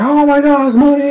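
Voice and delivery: high, thrilled squeal; excited gasp. Retold or imitated. Everyone sees the bear poop money, high squeal. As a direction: squeal it out in delight.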